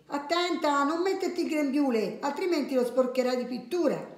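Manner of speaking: high-pitched acted motherly caution; mother's warning. Story mother tells Lucia not to wear an apron, high warning.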